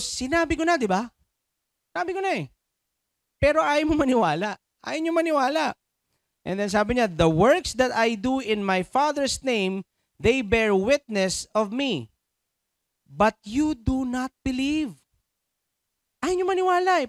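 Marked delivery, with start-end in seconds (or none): 0.0-5.8 high-pitched, insistent exasperation; exasperated retort. Acted. Preacher voicing Jesus' reply to unbelievers, exasperated. 7.1-15.0 slow, raised-pitch emphatic reading; scripture reading. Acted. Reads Jesus' words from scripture. 16.2-17.1 high, loud exasperated outburst; exasperated complaint. Acted. Preacher restating Jesus' complaint to unbelievers, exasperated.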